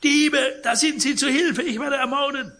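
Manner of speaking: high, loud cry of alarm; piercing scream. A narrator's reading. Sick man wakes to thieves at his bed, piercing scream. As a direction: scream for help at the top of your range. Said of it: "stieß einen durchdringenden Schrei aus".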